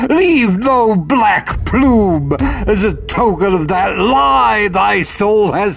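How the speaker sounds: loud, high, raging command; furious shout. Acted. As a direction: drive the bird out with your voice. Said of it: "I shrieked".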